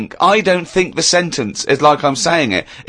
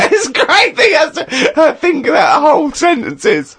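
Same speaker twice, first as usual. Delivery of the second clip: laughing; 8 semitones higher than usual. Real, not acted. Laughing at Karl's thinking.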